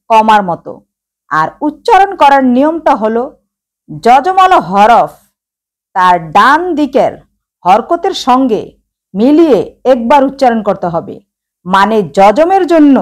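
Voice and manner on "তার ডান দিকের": ordinary talk, nothing marked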